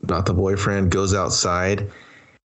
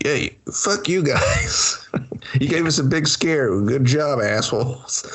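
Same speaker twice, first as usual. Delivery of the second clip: slow raised sarcastic voice; mock sarcastic taunt; 6 semitones higher than usual. Man mocking the cultists, slow sarcastic mock voice. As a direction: drawl a fake thank-you.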